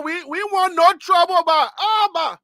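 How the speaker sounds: playful Liberian English, pleading for peace; Liberian accent. Acted. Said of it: "Let me put in Liberian".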